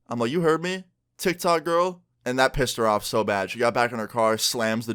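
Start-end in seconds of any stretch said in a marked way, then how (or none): 0.3-2.0 higher-pitched mock defiance; retold taunt. Taunting his ex after her question, retold taunt. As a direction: throw the insult back, pitched up.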